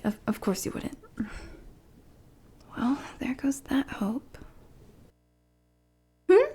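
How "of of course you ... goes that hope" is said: breathy under-the-breath mutter, much softer; whispered aside. Acted. Bodyguard muttering her disappointment to herself, whispered. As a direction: let it slip out to yourself, barely audible.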